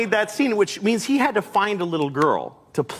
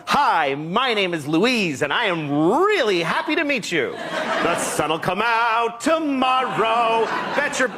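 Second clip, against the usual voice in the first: put-on slow, showy little-girl voice breaking into song; over-rehearsed child star; 5 semitones higher, slower than usual. Coached girl wows casting directors, showy slow child voice. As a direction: overeager stage kid, drawn-out and rising. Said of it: "comes the girl who's amazing".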